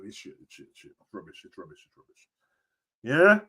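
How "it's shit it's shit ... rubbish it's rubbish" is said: muttered, trailing to nothing; whispered dismissal; fading whisper. Real, not acted. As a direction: repeat it softer till it's gone. Repeated verdict fading out.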